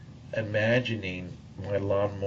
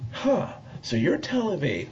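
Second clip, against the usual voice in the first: much higher, a surprised huh; dawning surprise; 9 semitones higher than usual. Retold or imitated. To the voice in his head, realising the catch, surprised. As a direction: let the realisation lift the voice.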